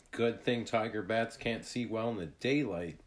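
plain reading voice

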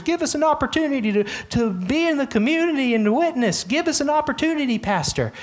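high, fast begging voice; pleading churchgoers. Retold or imitated. Congregants begging the pastor for a chance, high and fast. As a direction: whine it like an eager congregant. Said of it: "we're often asking pastors".

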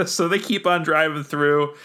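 ordinary talk, nothing marked

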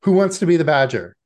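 put-on high, loud, fast eager call to the kids; host calling out. Game host calls the children to play, bright and loud. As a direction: sing it out to the whole room.

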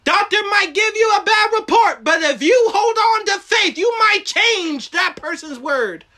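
rising shouted preaching. Acted. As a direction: preach it loud and high. Preaching at full force.

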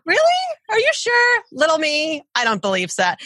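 high, incredulous self-doubt; mock disbelief, jumping up high on are you sure; doubtful ego voice. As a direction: needle yourself like a sceptical inner critic. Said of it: "that second voice is our ego".

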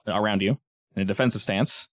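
usual speaking voice

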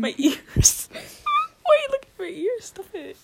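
high, loud, laughing complaint; laughing protest. Real, not acted. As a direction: protest through real giggles, pitch shooting up. Laughing protest about being looked at.

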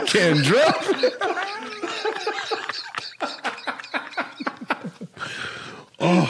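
high words through laughter; laughing hard. Real, not acted. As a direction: giggle out the words. Laughing at profile.

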